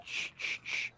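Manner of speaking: unvoiced shh shh shh; soft mock shushing; whispered shushing. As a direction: hush like static on a spirit box. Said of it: "It goes".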